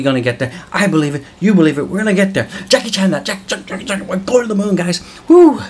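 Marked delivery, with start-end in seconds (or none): 2.7-5.0 high, hyped-up rallying call; rally the viewers to the moon; excited. Real, not acted. 5.3-5.6 loud whooping cheer; high excited woo. Real, not acted. Cheering to the moon.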